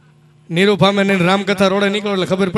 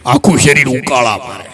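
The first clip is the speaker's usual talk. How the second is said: put-on old man; 6 semitones lower than usual. Old man complains to doctor.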